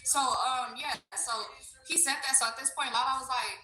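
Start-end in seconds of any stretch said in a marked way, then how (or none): none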